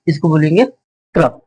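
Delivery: normal talking voice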